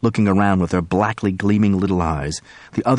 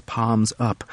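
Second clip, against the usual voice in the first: usual speaking voice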